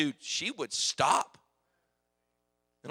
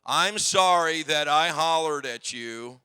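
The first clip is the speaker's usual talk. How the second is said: slow, upright, louder apology; proper apology; 6 dB louder, slower than usual. Retold or imitated. Son redoing the apology shoulders back for mom, slow and firm. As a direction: stand tall and say it properly. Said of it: "put your shoulders back".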